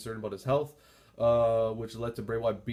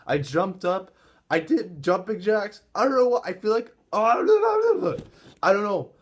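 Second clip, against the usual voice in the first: higher and louder, breathless; breathless excitement; 7 semitones higher, 9 dB louder than usual. Real, not acted. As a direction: heart still racing. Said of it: "My heart's still racing".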